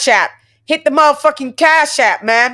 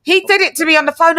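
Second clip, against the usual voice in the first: normal talking voice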